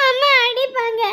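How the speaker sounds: put-on wailed child's plea; crying. Child insists mother will hit them, crying. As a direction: insist through tears. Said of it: "இப்ப எதுக்கு அழுவுற?".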